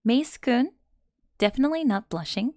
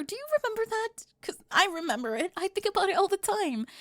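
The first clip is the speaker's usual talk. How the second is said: higher, rising pitch, pressing each line in disbelief; agitated disbelief; 12 semitones higher than usual. Acted. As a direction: she can't believe he still hoped it was her. Disbelieving outburst about past cruelty.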